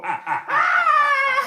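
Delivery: long, high, loud laughing wail; mock ghost wail. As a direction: hoot it like a silly ghost. Playful spooky cellar noises.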